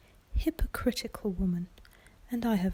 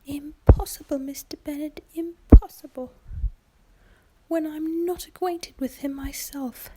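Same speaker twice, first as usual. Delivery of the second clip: higher, louder whispered protest; exasperated mother; 7 semitones higher, 8 dB louder than usual. Acted. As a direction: protest as the flustered wife, pitched up. Mrs Bennet protests to husband.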